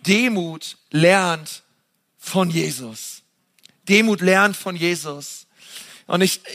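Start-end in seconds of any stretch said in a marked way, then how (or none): 0.1-3.2 slow, weighty and deliberate, each word set apart; slow solemn sermon emphasis. Acted. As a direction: lay the words down slowly, as the key thought. Preacher slowly stresses key phrase.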